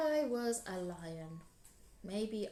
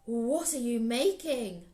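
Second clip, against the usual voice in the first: loud, eager call to the lion; an excited spider rushing over; 3 semitones higher, 10 dB louder, slower than usual. Acted. Anansi calls out eagerly to Lion, loud and keen. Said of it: "he scuttled over and said".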